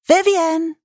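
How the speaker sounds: high, loud call across the moor; girl calling her friend's name, loud and high. A narrator's reading.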